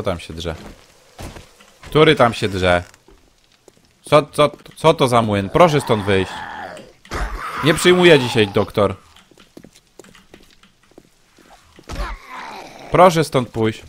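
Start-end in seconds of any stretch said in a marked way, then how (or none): 1.8-13.8 loud, irritated orders to get out; annoyed shouting. Real, not acted. Streamer yelling at a noisy in-game intruder to leave, loud and irritated. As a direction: raise your voice to throw him out.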